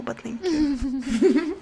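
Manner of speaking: slow laughing thank you. Real, not acted.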